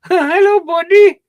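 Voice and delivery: high, loud fake-friendly hello; mock clerk greeting. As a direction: laugh into a mock shopkeeper hello. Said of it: "their nice way of flipping you off".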